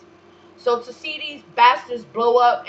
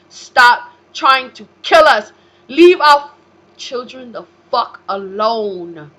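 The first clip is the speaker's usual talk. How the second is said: slow, much louder yelling; furious shout; 4 semitones higher, 11 dB louder, slower than usual. Real, not acted. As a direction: bellow each word at them. Ranting at enemies.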